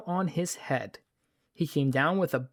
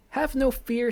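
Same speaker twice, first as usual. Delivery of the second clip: "Have no fear" lifted much higher in pitch; 10 semitones higher than usual. Acted. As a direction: jump up high on "Have no fear". Cat reassures, said twice.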